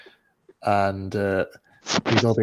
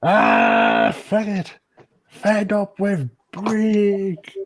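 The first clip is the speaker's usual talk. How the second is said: slow puppet grumble; 11 semitones higher, 6 dB louder, much slower than usual. Acted.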